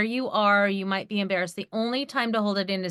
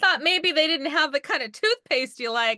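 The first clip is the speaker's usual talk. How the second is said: joking; 6 semitones higher than usual. Real, not acted. Joking reaction, laughing.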